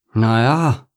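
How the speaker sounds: doubtful little grumble; grumbled. A narrator's reading. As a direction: grumble it, unconvinced. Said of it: "brummte Egon".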